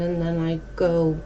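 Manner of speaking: ordinary talk, nothing marked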